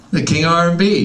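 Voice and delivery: pitched high and loud with mock reverence; hyped-up praise. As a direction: crown him the king. Hyping [name], exaggerated.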